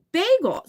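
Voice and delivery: bagel said high as BAY-gul; long A. Shown as a demonstration. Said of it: "it is a A, right?".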